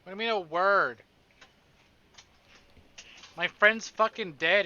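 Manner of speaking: loud, indignant, the last line slowed and heavy; angry outburst. Acted. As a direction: snap back in disbelief at the boss. Voicing heroine's angry retort.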